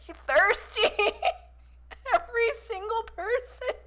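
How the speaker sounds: high, laughing jab at the game; laughing tease. Real, not acted. Streamer teasing the game about thirsty players, laughing. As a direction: tease it through giggles.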